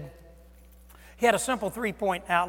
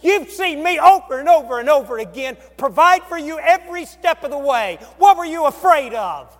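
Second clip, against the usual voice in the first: building rebuke; 5 semitones higher, 10 dB louder than usual. Acted. Jesus reminding the disciples, rising to a loud cry. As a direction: build each line louder until it peaks.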